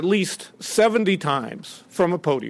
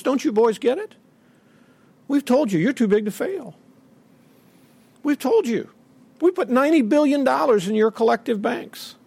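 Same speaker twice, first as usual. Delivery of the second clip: higher, patronising official talking down; mock Treasury official; 6 semitones higher than usual. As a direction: talk down to the bankers like a smug official. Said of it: "officials ... say to those folks".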